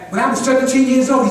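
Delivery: ordinary talk, nothing marked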